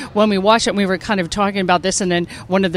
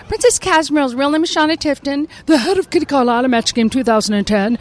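high-pitched princess character; cartoon character voice; 6 semitones higher than usual. Shown as a demonstration. Actress rattling off her characters, high princess voice. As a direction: switch up into a princess voice. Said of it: "thank you very much for doing the voices".

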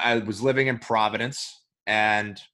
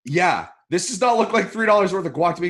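fast, raised, pointed complaint; indignant complaint; 7 semitones higher, 4 dB louder, faster than usual. Retold or imitated. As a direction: insist you've been shortchanged. Confronting counter guy.

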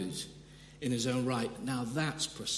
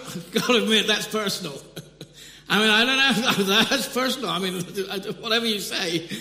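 real laughter, loud and higher throughout; laughing; 6 semitones higher, 9 dB louder than usual. Real, not acted. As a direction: laugh through the words, tickled by it. Laughing at the odd detail.

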